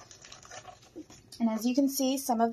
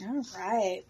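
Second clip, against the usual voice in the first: usual speaking voice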